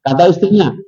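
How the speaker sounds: ordinary talk, nothing marked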